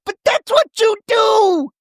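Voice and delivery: very high, loud retort; hammed-up high yell; outraged mock shriek. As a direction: screech it back like an outraged housewife. Imitating Shannon yelling back in argument.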